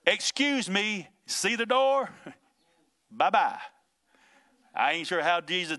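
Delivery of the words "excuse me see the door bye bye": high, drawn-out, sing-out "excuse me" with a chuckle; mock-polite dismissal. Preacher as Jesus clearing out the laughing mourners, mock-polite dismissal. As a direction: playfully show the scoffers out, pitched up.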